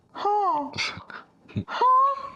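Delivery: high, exaggerated double huh; mock baffled huh. Daughter reacting to dad with high, exaggerated confused huh. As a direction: act stunned and confused. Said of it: "I went just like this to him".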